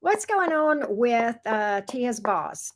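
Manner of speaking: ordinary talk, nothing marked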